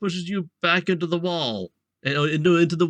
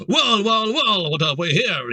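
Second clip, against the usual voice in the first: gravelly voice; 4 semitones higher, 4 dB louder than usual. Acted. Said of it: "he asks in a gravelly voice".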